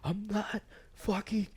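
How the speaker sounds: put-on drunk guy. Drunk guy snaps back.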